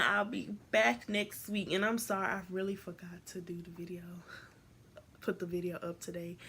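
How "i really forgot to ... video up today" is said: soft whisper; whispered aside. Real, not acted. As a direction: confess it quietly. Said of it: "aside to viewers".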